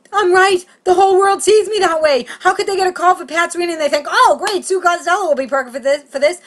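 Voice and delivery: high, loud indignant tirade; outraged rant. Retold or imitated. Comic reacting to audition call, outraged rant. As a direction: shout it high all the way through. Outraged at being cast as Pat.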